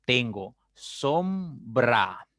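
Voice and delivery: "sombra" drawn out slowly into syllables. Shown as a demonstration. Teacher sounding out the example word. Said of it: "Por ejemplo".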